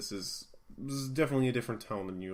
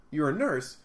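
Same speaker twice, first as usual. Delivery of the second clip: ordinary delivery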